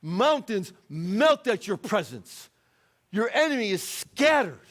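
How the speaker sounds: high, loud prophet's cry to heaven; frustrated plea. Acted. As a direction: cry out in exasperation, punching each phrase. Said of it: "he starts to get frustrated".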